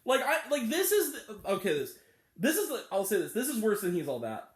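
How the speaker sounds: ordinary delivery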